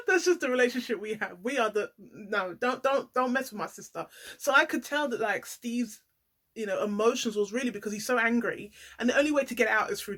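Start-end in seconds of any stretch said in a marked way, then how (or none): none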